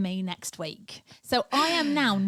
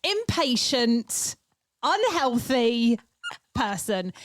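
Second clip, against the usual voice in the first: each word dragged out slowly; slow emphatic scolding; 2 semitones higher, much slower than usual. Acted. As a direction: spell out every word to scold.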